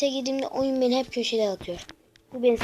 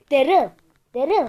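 calling out; 3 semitones higher, 7 dB louder than usual. Real, not acted. Calling out to teammate Daryl.

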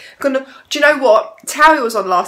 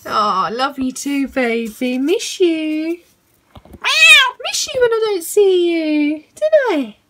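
doting pet-talk voice; cooing to a pet; 4 semitones higher, slower than usual. Real, not acted. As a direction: coo lovingly, stretching the words. Cooing affection to her cat.

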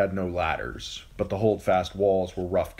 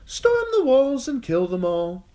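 bored; 7 dB louder than usual. A narrator's reading. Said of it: "in a bored voice".

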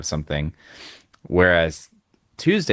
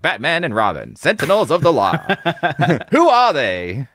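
put-on booming old-time radio announcer; 1940s announcer voice; 8 semitones higher, 3 dB louder than usual. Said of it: "that, like, nineteen forties, like, uh, a-, announcer voice".

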